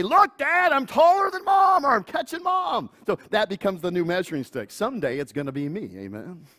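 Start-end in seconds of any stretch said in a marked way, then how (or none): 0.0-2.9 put-on much higher, louder teenage boast; excited teen. Teenager boasting to dad about height, excited shout. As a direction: shout it like a proud growing kid. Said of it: "The other day".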